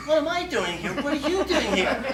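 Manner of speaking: high, laughing disbelief thrown back; incredulous retort. Retold or imitated. Boyfriend answers girlfriend's question with high laughing disbelief.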